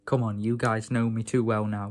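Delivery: normal talking voice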